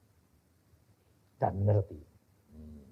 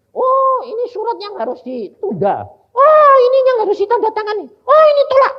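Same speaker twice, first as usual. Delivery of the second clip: each oh higher and louder; thrilled exclamations; 14 dB louder than usual. Retold or imitated. Preacher reliving sorting prayers on the Lord's chair, building higher and louder. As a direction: rattle off the verdicts, delighted.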